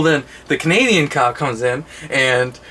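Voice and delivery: ordinary talk, nothing marked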